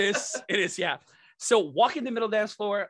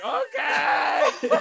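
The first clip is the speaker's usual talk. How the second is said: laughing okay; 10 semitones higher, 6 dB louder than usual. Retold or imitated. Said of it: "I'm like".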